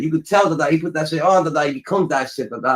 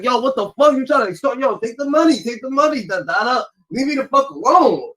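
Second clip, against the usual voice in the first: pitched-up panicky voice for a scared Shannon; nervous Shannon; 7 semitones higher than usual. Retold or imitated. Streamer imitating Shannon panicking over an extortion claim, higher and frantic. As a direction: play him rattled and begging to be left alone.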